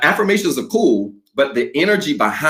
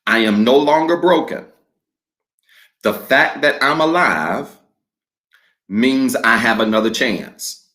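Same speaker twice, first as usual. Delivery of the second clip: slow, measured declaration with long pauses for the congregation to repeat; call-and-response declaration, slowed way down; slow declaration reading; slower than usual. Real, not acted. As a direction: read each phrase slowly and leave room for echoes. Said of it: "I'm gonna read it slowly".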